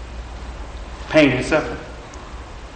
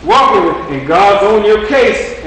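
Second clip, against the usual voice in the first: slow, higher, louder accusing voice; hammed-up devil pointing the finger, slowly; 6 semitones higher, 5 dB louder, slower than usual. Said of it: "then he says".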